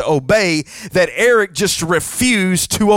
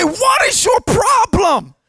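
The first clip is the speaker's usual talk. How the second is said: annoyed yell; 11 semitones higher, slower than usual. Retold or imitated. Friend yells at screaming roommate.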